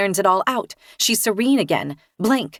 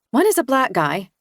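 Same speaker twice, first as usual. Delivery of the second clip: ordinary delivery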